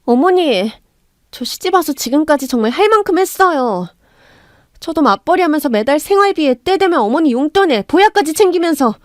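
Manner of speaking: daughter-in-law bursts out at her mother-in-law, aggrieved; pent-up anger bursting. Acted. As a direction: let years of grievance spill out. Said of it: "울분을 터뜨렸습니다".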